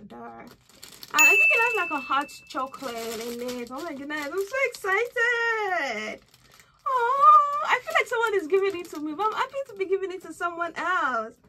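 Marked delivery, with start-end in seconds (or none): 4.3-6.2 excited squeal. Real, not acted. 6.9-11.4 excited gush. Real, not acted.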